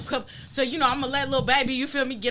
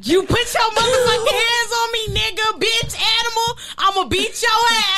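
loud, high mock-angry rant; furious yelling; 11 semitones higher, 6 dB louder than usual. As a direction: yell it, hamming up the fury.

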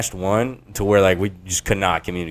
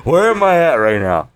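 retold drunken bewilderment; 6 semitones higher, 4 dB louder than usual. Acting out his drunken confusion.

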